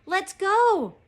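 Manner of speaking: loud, high-pitched urging; urgent storybook shout; crying out. A narrator's reading. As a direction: yell for a friend to leave now. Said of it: "cried Annie".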